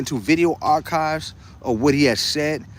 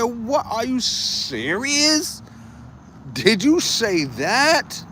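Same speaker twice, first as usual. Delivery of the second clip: high, slow, softer and drawn-out "serious?"; stunned disbelief; 8 semitones higher, 3 dB quieter, much slower than usual. Retold or imitated. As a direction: let the disbelief hang, slow and quiet. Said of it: "I said".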